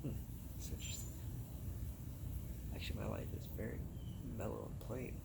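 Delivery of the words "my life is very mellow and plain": ordinary delivery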